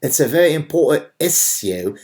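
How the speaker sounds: hammed-up posh British accent mocking John Oliver. Commentator mocks a British talk show host, in a put-on British accent. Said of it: "British John Oliver".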